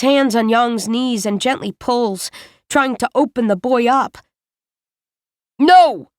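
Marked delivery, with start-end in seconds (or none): 5.6-6.1 loud sharp cry of refusal; pained shout. A narrator's reading. Yang crying out as Aramovsky pulls his knees, loud. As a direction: cry out in pain.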